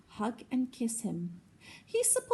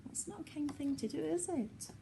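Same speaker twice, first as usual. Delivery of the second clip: put-on reading to children; 6 semitones higher, 7 dB quieter, faster than usual. Asks the children a question.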